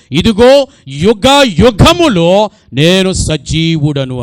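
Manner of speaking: slow, loud, solemn proclaiming voice; voice of Jesus. Acted. Preacher voicing Jesus to the church, slow and loud. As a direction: boom it out slowly as the Lord. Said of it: "ఆయన అన్నారు".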